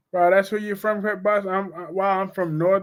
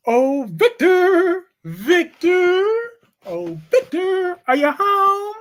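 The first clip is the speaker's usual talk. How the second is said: high, drawn-out sing-song call of a wife; mock wife calling; 12 semitones higher, 4 dB louder, much slower than usual. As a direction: croon his name like a clingy wife. Imitating a wife calling Victor.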